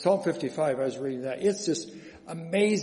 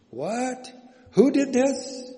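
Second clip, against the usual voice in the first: mock surprise; 8 semitones higher, 5 dB louder, much slower than usual. Mock astonished question to congregation.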